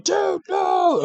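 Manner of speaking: high shouted protest; mock shout. Friend protesting the spoiler with a high mock shout. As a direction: yell it out, horrified. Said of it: "he's like".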